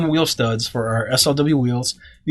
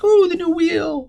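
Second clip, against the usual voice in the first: excited. Retold or imitated. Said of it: "everybody was like".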